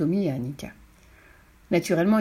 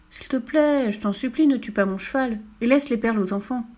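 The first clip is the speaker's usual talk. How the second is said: higher-pitched, mock-earnest plea; pleading; 4 semitones higher than usual. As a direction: beg in a raised pitch, easing off. Pleading for the horse. (supplication, voix plus aiguë)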